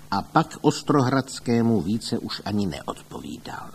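ordinary delivery

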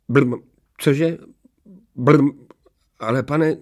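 high, bleating, spluttering servant; startled old devil. A narrator's reading. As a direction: splutter in shocked protest. Starý čert zaskočený rozkazem. (zaskočený čert, vysokým hlasem)

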